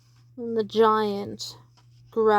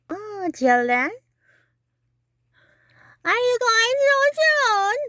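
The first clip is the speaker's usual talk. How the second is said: witch voice; 13 semitones higher, 6 dB louder than usual. Acted. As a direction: croon at the children like a witch. Witch voice greeting children.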